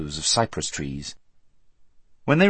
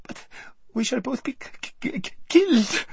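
very high, stuttering k- k-; spoiled girl stuttering in terror; terrified stammer. A narrator's reading. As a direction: stammer the word in panic. Lazareline stammers in fear.

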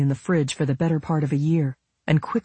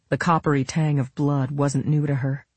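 usual speaking voice

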